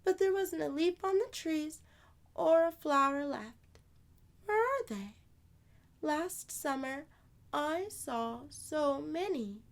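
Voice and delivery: put-on high little girl, sadly. Amani grieving her dead flowers, high childlike voice. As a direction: play a small girl mourning her flowers.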